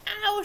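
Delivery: sharp high yelp of pain; a quick burnt-tongue ow, high and loud. A narrator's reading. Goldilocks burns her tongue on porridge, a high yelp. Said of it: "she cried".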